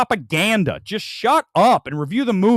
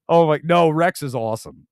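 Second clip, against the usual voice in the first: ordinary talk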